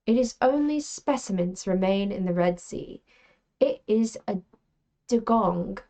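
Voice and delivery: put-on British accent for story reading. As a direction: read it aloud as if British. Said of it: "for some British behavior".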